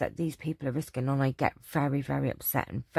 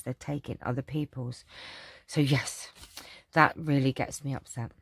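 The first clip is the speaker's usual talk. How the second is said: usual speaking voice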